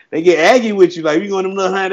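usual speaking voice

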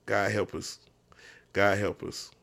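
low, soft, defeated muttering; a hopeless prayer under the breath; despairing mutter. Retold or imitated. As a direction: mutter it like you've given up on the team. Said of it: "I was just like".

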